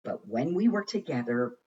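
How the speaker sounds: usual speaking voice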